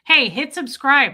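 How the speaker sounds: ordinary delivery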